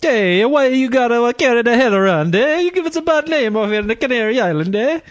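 high, loud Italian-American tough guy voice; mock Sopranos-style Italian; hammed-up Italian accent, fast. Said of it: "That's a good Italian accent there".

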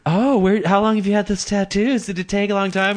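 put-on high-pitched, chatty girl voice; girl at a bar. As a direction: gush questions like a curious girl at a bar. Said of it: "some girl sees you, and she's like".

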